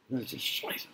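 very soft whispered aside; whispered swearing. Real, not acted. Lecturer comparing swear words with Spanish, whispering. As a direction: whisper the swear word to the class. Taboo word dropped into lecture.

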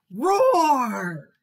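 loud, drawn-out falling roar; story-time tiger roar; little tiger roar. A narrator's reading. Tiger roars at elephant.

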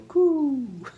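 high-pitched imitated baby coo. Reader shows children what the baby sounded like, cooing.

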